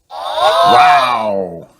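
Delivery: high, loud shout of wonder; amazed wow. Acted. Family amazed by the singing toy, loud high wow. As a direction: gasp it out together in awe. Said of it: "says everyone".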